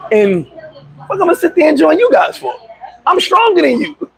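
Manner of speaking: high-pitched mock-indignant protest; indignant boast. Refusing to join the guys' gang, indignant. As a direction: argue it up high, scoffing at the offer.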